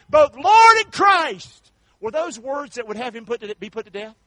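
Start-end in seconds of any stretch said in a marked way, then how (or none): 0.1-1.7 slow, loud, high climax shout; preached climax. Acted. Peter proclaiming Christ to the crowd, slow loud shout. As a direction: drive the last words home.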